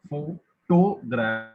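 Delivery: PHOTOGRA- drawn out slowly, with TO punched; stress demonstration. Shown as a demonstration. Reader showing where to stress the word, photogra- slow with weight on TO. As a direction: say photogra- in slow pieces, hitting TO hardest. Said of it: "when and where to stress the word".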